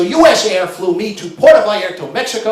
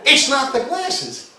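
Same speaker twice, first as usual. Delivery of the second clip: mock clerk insisting; 6 semitones higher, slower than usual. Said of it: "she says".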